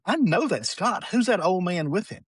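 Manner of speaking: high-pitched granny retort, retold; insistent grandmother. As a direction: imitate granny setting them straight. Grandmother correcting the mother, retold.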